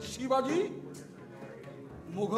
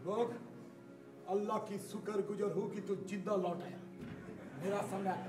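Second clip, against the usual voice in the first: low, quiet voice; 7 semitones lower, 15 dB quieter than usual. Acted. Commander speaks low to survivor.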